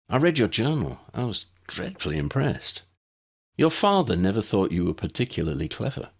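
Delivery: a hint of a fond old teacher coaxing a lost pupil; Ambrose, kindly elder. Old Ambrose pleads with Granville.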